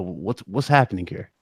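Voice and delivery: ordinary delivery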